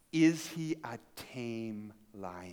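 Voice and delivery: put-on hushed, slow, fearful child's question; timid question. A narrator's reading. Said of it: "she was a little bit afraid".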